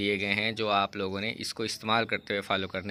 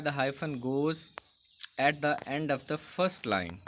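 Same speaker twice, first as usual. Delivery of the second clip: reads the rule slowly, word by word; slow textbook reading; 4 semitones higher, much slower than usual. Shown as a demonstration. Teacher reading the rule aloud to students, slow and deliberate. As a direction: dictate the rule slowly to the class.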